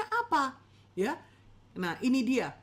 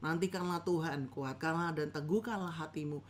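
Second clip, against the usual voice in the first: mumbled and softer, as if gargling the words; the wrong way to read Scripture: mumbling, softer; 6 semitones lower, 6 dB quieter than usual. Shown as a demonstration. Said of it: "Nggak gitu, saudara".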